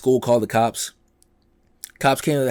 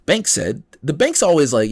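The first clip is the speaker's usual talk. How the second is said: ordinary talk, nothing marked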